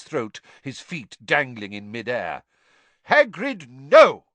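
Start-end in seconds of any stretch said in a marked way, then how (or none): 3.0-4.2 high, loud shouted stop; shouting. Acted. Dumbledore to Hagrid, shouting him to stop. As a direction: shout to halt him at once. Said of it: "Dumbledore shouted".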